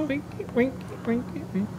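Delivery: very high, squeaky and playful; squeaky cartoon voice. Playful bit about the lamp.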